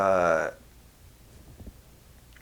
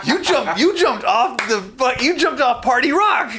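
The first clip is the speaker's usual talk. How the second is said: much higher, louder, laughing disbelief; excited recognition; 12 semitones higher, 4 dB louder than usual. Retold or imitated. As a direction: blurt it out, thrilled to recognise him.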